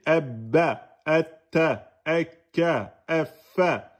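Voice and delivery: Ebba, etta, ekka, effa, each double consonant pressed before its vowel; doubled consonants drilled. Shown as a demonstration. Quran teacher drilling the class, consonants pressed and held. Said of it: "vous y allez".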